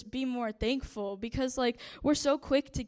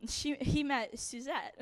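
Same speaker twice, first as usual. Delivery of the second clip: ordinary delivery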